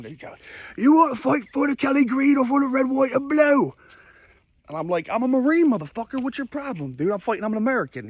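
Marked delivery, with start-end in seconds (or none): 0.8-3.7 high, loud mock-British roar; angry British yelling. Angry Brit repeats his challenge. 5.1-8.1 high, raised-voice retort, acting out the standoff; defensive comeback. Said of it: "I'm in a defensive posture".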